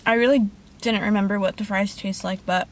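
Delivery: eating between words; talking while chewing. Real, not acted. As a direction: mouth partly full. Seen 0: bites fries at 1.8-2.2, chewing and holding fries throughout.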